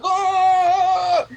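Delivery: high, sharp imitated moan of a man in a seizure; mock moan. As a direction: yelp it out like a man in a fit. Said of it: "moaning like".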